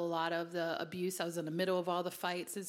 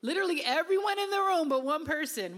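higher and louder, laughing through it; laughing disbelief; 9 semitones higher, 7 dB louder than usual. Real, not acted. Preacher tells congregation about herself, laughing. Amused at her own story.

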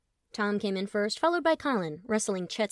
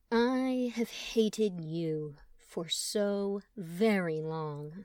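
seething; much slower than usual. Acted. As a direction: let each word seethe out slowly. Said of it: "each word seething".

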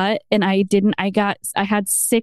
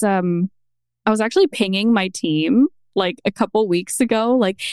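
ordinary delivery

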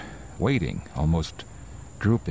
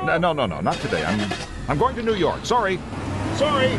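panicked refusal. Acted. Driver refusing the hitchhiker, panicked and high-pitched. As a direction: brush him off in rising panic.

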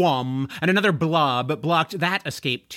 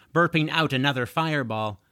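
plain reading voice

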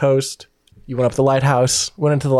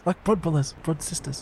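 softer Antipodean badger voice; Australian or New Zealand accent; 3 semitones higher, 8 dB quieter than usual. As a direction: confide it softly in an Aussie-Kiwi accent. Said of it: "I want Australian and or New Zealand".

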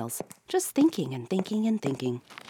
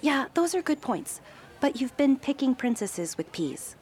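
higher, offhand; shrugging reply; 10 semitones higher than usual. A narrator's reading. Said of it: "with a shrug".

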